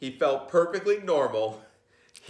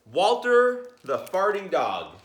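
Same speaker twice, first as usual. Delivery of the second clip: grand title announcement; 6 semitones higher, 5 dB louder, slower than usual. Acted.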